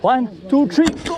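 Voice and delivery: high straining count to lift; lifting together. Real, not acted. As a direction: call the count, rising to go.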